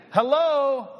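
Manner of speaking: loud incredulous "Hello?"; pointed rhetorical call to the room; emphatic hello. Real, not acted. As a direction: wake them up with a pointed hello. Calling out to congregation.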